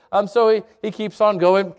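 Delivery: ordinary talk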